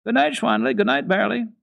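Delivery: put-on high, loud little-girl call; a small girl calling goodnight to the goats. Heidi calling goodnight to the goats, loud high child voice. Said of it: "called Heidi at the top of her voice".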